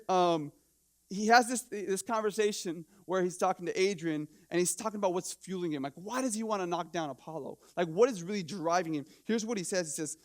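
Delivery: ordinary talk, nothing marked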